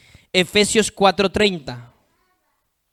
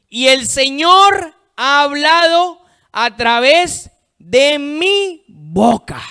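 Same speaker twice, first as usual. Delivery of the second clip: slow, raised, emphatic scripture quote; cita bíblica proclamada; 7 semitones higher, 5 dB louder, slower than usual. Real, not acted. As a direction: proclaim each phrase slowly and loudly. Cita a [name] predicando. (versículo proclamado lentamente)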